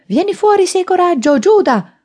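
very high, loud daring shout; yelled challenge. A narrator's reading. Man daring a hidden traitor to come out, furious yell. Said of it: "urlò".

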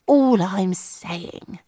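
very slow, weary sigh; tired husband sighing at neighbours. A narrator's reading. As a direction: drag it out tiredly. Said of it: "Tom had sighed".

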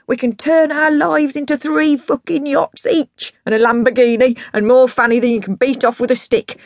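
put-on high, rousing drunken pitch; grandiose excited dad. Dad promising Rock Perry yachts, excited. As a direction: rave about riches, then give an order.

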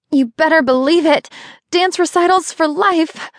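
high, bright, defiant cheer; mother answers partner with high excited cheer. Acted. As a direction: call it out with a burst of joy. Excited reply during labour.

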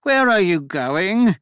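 high, drawn-out called question; calling out. A narrator's reading. Devon chasing the Duchess, high stretched-out call. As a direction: call it after her, slow and high. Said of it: "de Ludo chased after her".

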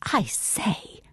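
breathy hushed whisper. A narrator's reading. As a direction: whisper it under your breath. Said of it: "she whispered".

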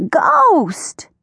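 high, drawn-out storybook cry; alarmed cry. A narrator's reading. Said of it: "he cried".

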